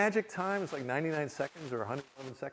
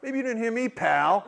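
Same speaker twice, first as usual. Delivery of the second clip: raised, punchy mock challenge; acted-out bristling retort; combative comeback; 6 semitones higher, 8 dB louder than usual. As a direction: land hard on "pal". Retelling his combative comeback.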